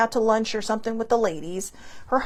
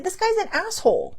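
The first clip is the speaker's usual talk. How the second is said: higher pitched, indignant verdict; retold indignation; 9 semitones higher than usual. Telling sibling about the doctor, high indignant tone. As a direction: spit out the judgement, pitched up.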